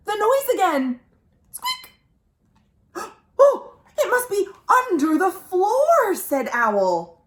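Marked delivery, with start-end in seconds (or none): 1.5-1.9 tiny high squeak, softer; a very high, soft mouse squeak. Acted. 3.4-6.1 higher, louder startled Owl; hammed-up startled owl; excited owl. Owl alarmed by noise.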